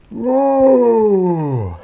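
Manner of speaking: high, loud, drawn-out whoa; startled whoa. Real, not acted. Vlogger startled mid-talk, loud high whoa. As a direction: yelp in sudden surprise. Sudden exclamation.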